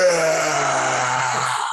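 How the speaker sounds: a second shorter grunting scream; crazed scream. Acted. Half ogre screams at Finis before swinging, crazed scream. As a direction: scream again as the eyes go crazy. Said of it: "he screams at you".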